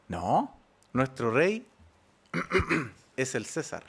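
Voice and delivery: low, slow, soft and cynical. Retold or imitated. The crowd rejects their king before Pilate, low and cynical. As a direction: drag it out coldly, with open contempt. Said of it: "Un cinismo absoluto".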